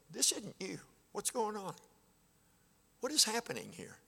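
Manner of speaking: gentle pleading. Retold or imitated. Pastor pleading at member's door.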